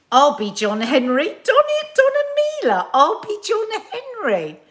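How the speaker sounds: very high, loud sing-out; hammed-up, high-pitched outcry; mock exclamation. Performing a viewer's odd exclamation.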